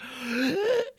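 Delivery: sharp gasp of shock, re-enacted; pained gasp. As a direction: act out gasping at sudden pain. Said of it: "immediately like".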